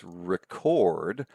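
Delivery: record as re-CORD; stress on second syllable. Shown as a demonstration. Lecturer shows where the accent falls, record said re-CORD. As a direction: punch the end of record: ri-KORD. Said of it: "the more pronounced syllable is the second one".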